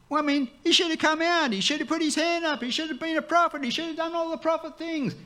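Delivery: sarcastic high-pitched parody of a grand prophet; mock indignant Naaman. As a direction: send up his expectations in a squeaky whine. Naaman's expected showy prophet, mocked.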